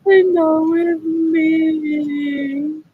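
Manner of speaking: slow, high whining mock sob; hammed-up jealous whimper; mock whiny crying. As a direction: whine it like a jealous kid about to cry. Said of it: "gonna cry and be like".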